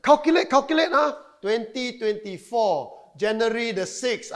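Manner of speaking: high, loud, wide-eyed gullible reader; mock amazement. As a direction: act out being hugely impressed by a prediction. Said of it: "So don't go and read". (pura-pura takjub dengan suara tinggi)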